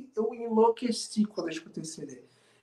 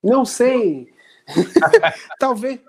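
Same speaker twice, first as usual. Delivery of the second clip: laughing through the answer; 4 semitones higher, 9 dB louder, slower than usual. Real, not acted. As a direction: laugh while saying it. Teased about Saturday guest.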